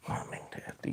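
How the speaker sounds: conspiratorial whisper. Real, not acted. Wary of YouTube overlords watching.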